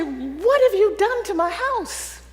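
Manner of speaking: high, slow, shocked cry at the workmen; alarmed accusation. Retold or imitated. Homeowner confronting workmen over her shaking house, high shocked outcry. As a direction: demand an answer in disbelief.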